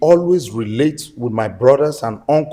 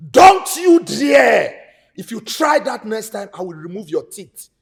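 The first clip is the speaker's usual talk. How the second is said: very slow, much higher, loud shout; furious threat; 5 semitones higher, 6 dB louder than usual. Acted. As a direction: bark the warning at her. Brother threatening sister.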